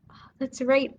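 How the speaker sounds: usual speaking voice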